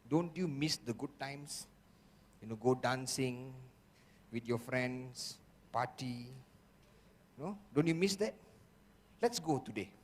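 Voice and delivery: mild sweet-talking inner voice; soft coaxing. Retold or imitated. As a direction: lure him gently back to his friends. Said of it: "soft approach".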